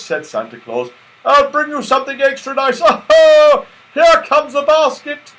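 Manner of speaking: high, loud cheerful goodbye; jolly Santa farewell. A narrator's reading. Santa bidding the children goodbye, booming jolly voice. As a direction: beam as you wave off.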